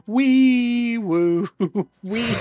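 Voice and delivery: high wailing "wee woo"; mock police siren. Co-host playing the weeb police, mock siren. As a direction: imitate a siren with the voice.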